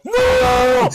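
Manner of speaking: high, loud shrieked no; screamed no. Real, not acted. Hearing the wrong answer, screaming no.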